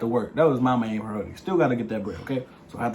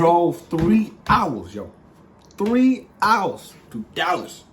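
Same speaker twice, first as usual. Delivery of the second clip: THREE HOURS stretched out high and loud; exasperated slow punch on "three hours"; emphatic complaint; 6 semitones higher, 6 dB louder, much slower than usual. Real, not acted. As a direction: drag out "three hours" slowly, pitched up and loud. Stresses the long drive he made.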